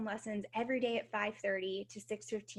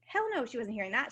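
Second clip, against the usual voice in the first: ordinary delivery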